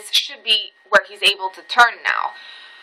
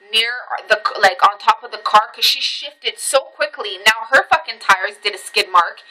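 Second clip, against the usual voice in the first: ordinary talk, nothing marked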